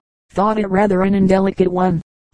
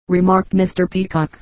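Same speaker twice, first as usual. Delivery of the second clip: ordinary delivery, nothing marked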